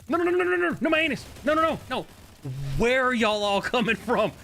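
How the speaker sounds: real gamer panic; panicked. Real, not acted. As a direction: freak out as they swarm you. Ambushed by many enemies.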